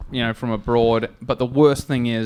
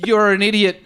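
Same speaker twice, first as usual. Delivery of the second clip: mock scolding; 5 semitones higher than usual. Ken scolding the driver.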